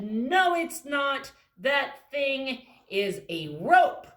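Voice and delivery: loud, high insistent protest; loud stubborn mouse; arguing mouse. Acted. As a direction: shout down the others. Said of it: "the blue one said".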